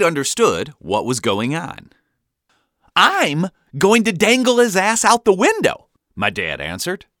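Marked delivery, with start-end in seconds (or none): 3.0-5.9 loud, high announcement; manic dad yell. A narrator's reading. Dad answering the baffled kids, yelling. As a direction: declare the mad plan at full volume.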